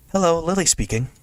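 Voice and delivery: high-pitched voice for a schoolgirl character; Lily on phone. Acted. Lily picking up her mother's phone, high-pitched greeting.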